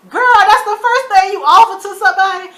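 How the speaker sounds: loud, high laugh through the words; cracking up at the scene; laughing disbelief. Real, not acted. Talking to the camera, laughing in disbelief.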